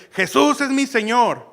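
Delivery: grito alto y lento, lleno de júbilo; proclámalo con alegría. Retold or imitated. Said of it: "lo gritamos con júbilo". (grito jubiloso y alto)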